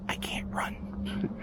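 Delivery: slow, breathy whisper; whispered joke about being unable to run away. Real, not acted. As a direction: whisper it slowly, half joking. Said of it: "there's a reason why I'm whispering".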